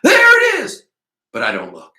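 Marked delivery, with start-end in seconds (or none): 0.0-0.8 very high, loud shriek; piercing full-force yell; screaming. A narrator's reading. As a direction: scream it out across the street.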